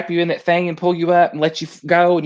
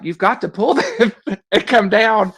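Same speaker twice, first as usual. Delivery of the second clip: higher-pitched mock holler through a laugh; retold hollering; 6 semitones higher than usual. Ride workers hollering up at stuck riders, mock holler with laughter. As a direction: laughingly imitate people calling up. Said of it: "they kept hollering at us".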